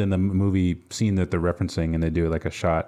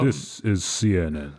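James Earl Jones voice; 2 semitones lower, slower than usual. Retold or imitated.

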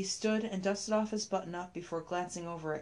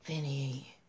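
weary, low sighed Vinny; sighing; 3 semitones lower than usual. A narrator's reading. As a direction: let the name out on a tired sigh. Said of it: "she sighed".

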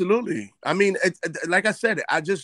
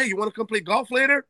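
cheery dad invite; 7 semitones higher, faster than usual. Retold or imitated. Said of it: "he goes".

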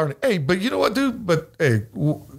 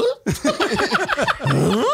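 high, baffled huh breaking into laughter. Retold or imitated. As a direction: act baffled, then crack up laughing. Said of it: "I'm baffled".

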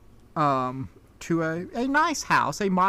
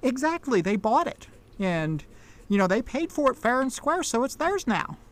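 put-on higher-pitched, earnest country child; little girl; 7 semitones higher than usual. Little girl defends the sale.

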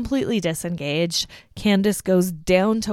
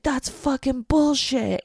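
mock angry, higher and slow; imitating an outburst; 6 semitones higher than usual. Kirk blowing up about the rooms, mock angry. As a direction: play a roommate losing it. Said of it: "And Kirk loses it".

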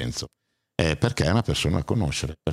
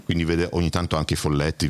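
normal talking voice

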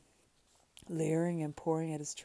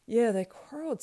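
excited; 8 semitones higher, 10 dB louder than usual. A narrator's reading. Sam greets rescued master.

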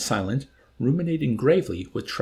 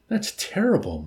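higher-pitched, pained dismay; grieving; 10 semitones higher than usual. A narrator's reading. Milo hearing the kid got killed, grieving. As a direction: lament it with feeling. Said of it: "Milo grieved".

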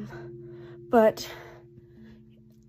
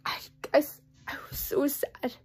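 sad whisper; 3 semitones higher, 5 dB quieter than usual. Real, not acted. Grandpa's death.

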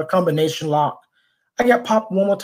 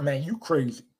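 quiet aside, much softer; under the breath; 9 dB quieter than usual. Retold or imitated. Onlookers commenting after he threatened the boys, under their breath. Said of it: "all anyone did under their breath".

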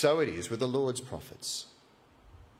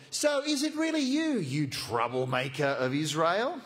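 sneering sledge. Acted. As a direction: taunt him like a rival at twenty paces. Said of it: "he now begins with a sledge".

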